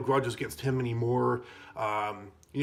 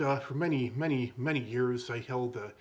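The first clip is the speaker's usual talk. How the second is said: ordinary talk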